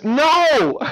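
high, loud refusal. Acted. Neighbor from the window shouting a final refusal.

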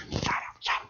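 urgent voiceless whisper; whispered shushing. Retold or imitated. Guys smothering their friend to silence him, whispered. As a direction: frantically hush him under your breath.

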